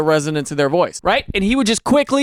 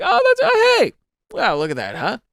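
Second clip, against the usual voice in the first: mock delight; 7 semitones higher than usual. Mock reaction, high then dropping.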